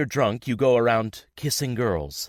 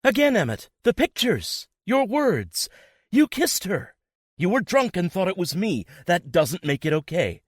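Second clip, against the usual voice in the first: much higher, loud outraged outcry; crying out; 10 semitones higher, 4 dB louder than usual. A narrator's reading. Girlfriend crying out at him in outrage. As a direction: hurl the facts at him. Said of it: "she cries".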